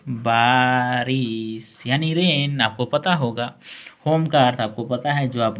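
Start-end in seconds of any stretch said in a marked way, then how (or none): 0.1-2.6 बारिश यानी रेन spoken very slowly; slow teaching repeat. Shown as a demonstration. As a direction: draw out each word for students. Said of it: "रेन का अर्थ होता है बारिश". (बहुत धीरे-धीरे समझाते हुए)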